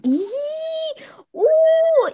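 high squeal of disgust, then a loud cry. Real, not acted. Reacting to a friend's joke about itchy hair with a squeal. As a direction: squeal in horror. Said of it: "reacting to itchy hair".

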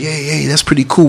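ordinary talk, nothing marked

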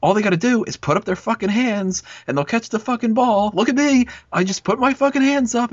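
ranting. Retold or imitated. Mocking teammates to a buddy at kickball, rising high rant. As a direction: build a mock tirade from high to very high. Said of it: "And I'm like".

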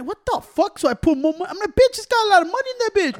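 high-pitched, incredulous protest; retold outburst at the card machine; acted indignant disbelief. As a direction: climb into a squeaky mock outrage. Acts out outrage at declined card.

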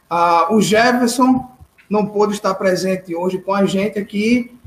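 usual speaking voice

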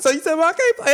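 high, loud child imitation; hammed-up kid challenging his coach; mock kid player. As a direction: protest in a put-on little boy's pitch. Mimics young player from Hardball.

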